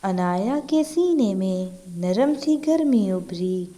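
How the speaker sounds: ordinary delivery, nothing marked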